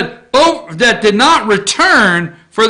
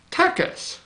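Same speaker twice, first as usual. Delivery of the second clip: Texas whispered, much softer and unvoiced; whispered aside; 7 semitones higher, 10 dB quieter than usual. Real, not acted. Whispers the repeated state.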